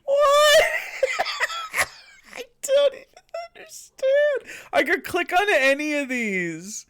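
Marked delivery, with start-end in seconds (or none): 0.1-4.7 very high squeal through laughter; real baffled giggling fit; helpless laughing bewilderment. Real, not acted. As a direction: squeak it out while cracking up. Laughing helplessly at absurd clip.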